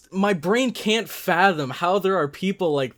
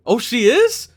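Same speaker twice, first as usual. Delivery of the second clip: surprised question; 7 semitones higher, 8 dB louder than usual. Real, not acted. Surprised at scene.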